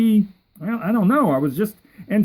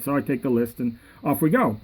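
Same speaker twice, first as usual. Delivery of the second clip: ordinary talk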